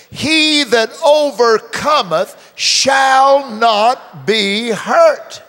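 loud, slow, high proclamation; full-force preacher declaring scripture; preaching at full force. Acted. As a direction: proclaim the verse to the crowd. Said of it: "Could you say it with me".

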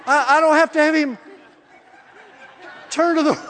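high, loud, incredulous reaction; acted amazement. Preacher reacting to God's trust in him, amazed. Acts out amazed reply to God.

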